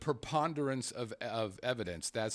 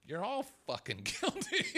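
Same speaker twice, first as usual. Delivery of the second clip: laughing mock verdict; 12 semitones higher than usual.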